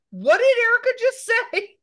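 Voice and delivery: high, loud put-on dad voice; mock a rural dad's outrage; hammed-up hillbilly dad accent; imitating dad's accent. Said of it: "It was a very racist accent there".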